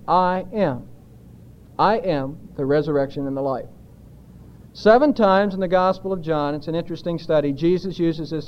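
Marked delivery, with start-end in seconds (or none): 0.1-3.7 "I am" set apart with a pause, low and measured; weight on "I am", then drop away softly; emphatic I AM. Shown as a demonstration. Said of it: "that's where the emphasis is".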